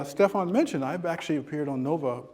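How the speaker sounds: ordinary talk, nothing marked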